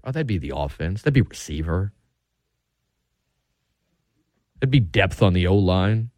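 sarcastic. Real, not acted. Sarcastic answer to own question.

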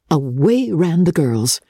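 ordinary delivery

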